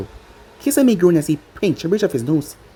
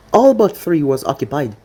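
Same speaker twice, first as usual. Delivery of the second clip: ordinary delivery, nothing marked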